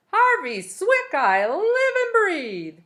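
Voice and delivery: high, loud, slow call of welcome; delighted greeting. A narrator's reading. Old woman on the porch greets Harvey, high and delighted. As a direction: sing out his name with delight.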